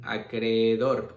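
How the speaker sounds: acreedor said slowly and carefully; drawing out acreedor so students hear both e's; slow example word. Shown as a demonstration. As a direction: acreedor stretched out for the class. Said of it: "Ahí hay".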